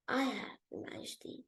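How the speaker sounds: potter mumbling a fearful admission; nervous near-whisper. Acted. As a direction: caught out, trailing into a whisper. Potter caught lying to king.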